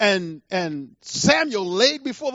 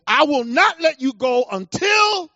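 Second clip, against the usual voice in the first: loud, defiant acted vow, pitched up; Jacob; 5 semitones higher, 5 dB louder than usual. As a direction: refuse with a raised, insistent voice. Acting Jacob refusing the angel.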